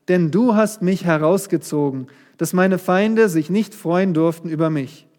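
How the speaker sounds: solemn scripture reading. Acted. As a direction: proclaim the psalm as a prayer of thanks.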